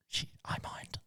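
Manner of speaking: whispered hint, almost no voice; mock whisper. Wife dropping a hint to her partner, mock whisper.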